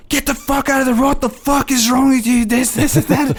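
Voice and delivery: hooligan road rage. Retold or imitated. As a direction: rant at other drivers like a football hooligan. Hooligan driver raging at traffic.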